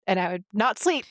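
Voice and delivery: ordinary talk, nothing marked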